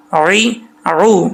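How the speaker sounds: عی عو drawn out from the throat; slow drill. Shown as a demonstration. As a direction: stretch عی عو. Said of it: "ہجے کریں اس کے،".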